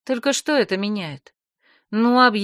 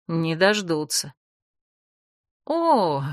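ordinary delivery, nothing marked

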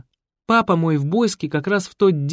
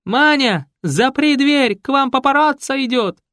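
high, loud shrieking alarm; 11 semitones higher, 6 dB louder than usual. Acted. Raisa shrieks to the girl to lock the door. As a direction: raise the alarm at full pitch. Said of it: "завизжала".

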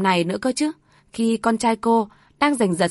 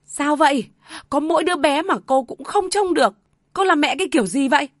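shrill rebuke; 6 semitones higher than usual. A narrator's reading. Grandmother angrily blaming the mother. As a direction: lash out at the mother in blame. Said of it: "bà vội rít lên".

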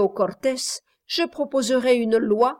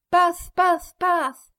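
high, loud squawking cry; goose cry; 8 semitones higher, 4 dB louder, much slower than usual. A narrator's reading. Goose keeps crying out.

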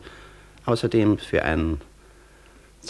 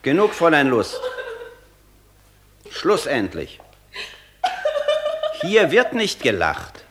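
sharp and stern, slowing on the last line; stern rebuke; 8 semitones higher than usual. Acted. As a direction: cut her laughter off like a strict master. Master scolds laughing secretary.

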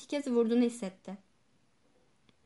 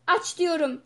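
raised, louder shouted order; shouted command; 4 semitones higher, 6 dB louder, slower than usual. A narrator's reading. Trembling doctor orders the guard to open up, shouted command. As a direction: shout it as a demand. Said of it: "diye bağırdı".

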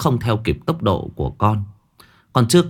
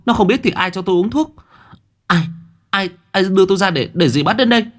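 angry demand; 10 semitones higher than usual. Acted. Thanh furious, accusing the aunt.

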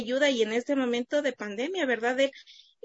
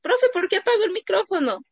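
put-on higher, childlike question; kids' voice; 9 semitones higher, 4 dB louder than usual. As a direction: voice a curious pupil asking. Kids voiced asking teacher.

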